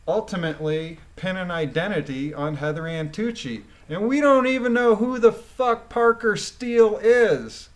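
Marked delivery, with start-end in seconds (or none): 3.9-7.7 louder and higher, furious at the hearsay; outraged outburst. Real, not acted. Commentator fuming at a witness's hearsay, angry outburst. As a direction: blow up at the absurdity.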